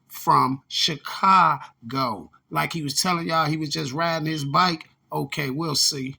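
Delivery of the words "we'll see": normal talking voice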